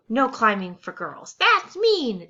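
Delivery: loud high playground taunt; mock shout. Child character shouting at others, loud and high. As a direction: yell it like a mean kid. Shouted teasing in read story.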